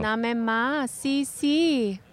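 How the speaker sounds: put-on Spanish-accented coo, drawn out.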